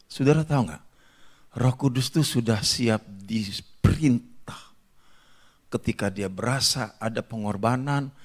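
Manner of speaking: ordinary talk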